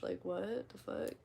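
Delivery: ordinary talk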